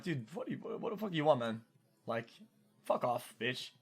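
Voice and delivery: imitating a cold brush-off of a text. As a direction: brush her off coldly, half-mumbled. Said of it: "I act really fucking cold".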